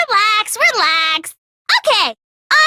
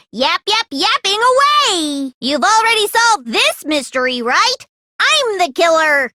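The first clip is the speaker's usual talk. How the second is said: normal talking voice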